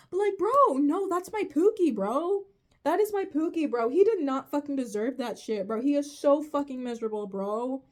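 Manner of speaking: impassioned rant; passionate defence. Real, not acted. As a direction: defend your favorite fiercely.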